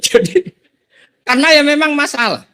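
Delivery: laughing. Real, not acted. Laughing at own story.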